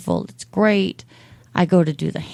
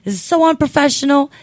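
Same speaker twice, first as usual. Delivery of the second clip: mock indignation; 7 semitones higher, 3 dB louder than usual.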